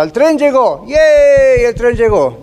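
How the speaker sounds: playful mock celebration; wife cheering. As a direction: imitate a wife celebrating good news. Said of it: "me llama mi esposa".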